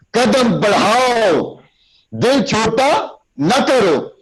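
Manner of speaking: high, slow, drawn-out exhortation; slow, lifted motivating call; rousing oratory. Shown as a demonstration. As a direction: stretch every word to stir them. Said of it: "لفظ کے اندر جان ڈالنی ہے".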